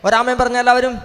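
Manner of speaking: high-pitched, urgent prayer declaration; fervent prayer. Real, not acted. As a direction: pray it out high and call for amens. (ഉയർന്ന സ്വരത്തിൽ തീക്ഷ്ണമായ പ്രാർത്ഥന)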